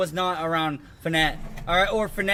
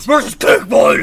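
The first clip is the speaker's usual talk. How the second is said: wrestling announcer; 10 dB louder than usual. Pretend. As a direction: hype the match like an announcer.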